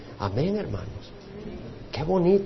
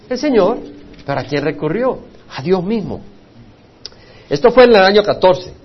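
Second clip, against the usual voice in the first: ordinary talk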